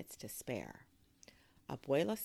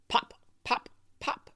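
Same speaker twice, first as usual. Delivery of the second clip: short, punchy pops; spaced bursts like kernels; popcorn popping; 10 semitones higher, 8 dB louder than usual. A narrator's reading. Narrator imitating first kernels popping, punchy bursts. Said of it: "they heard another sound".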